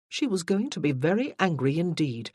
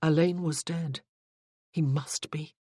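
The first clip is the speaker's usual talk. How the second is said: ordinary delivery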